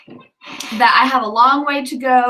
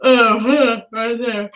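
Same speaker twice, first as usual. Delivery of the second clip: yawning; slower than usual. Real, not acted. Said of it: "I keep yawning like crazy".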